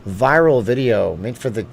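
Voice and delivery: ordinary talk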